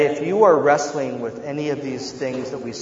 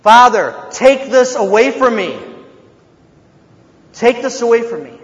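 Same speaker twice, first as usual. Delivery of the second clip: loud, higher pleading cry; crying out to God; 6 semitones higher, 6 dB louder than usual. Retold or imitated. Jesus pleading with the Father in Gethsemane, loud cry. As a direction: beg it out loud. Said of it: "he cried out to the Lord".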